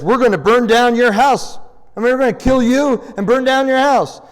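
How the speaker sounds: loud, high mock threat; angry threat. Insulted tribe threatens to burn Jephthah's house, acted angry threat. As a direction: threaten him like an offended mob, acted out.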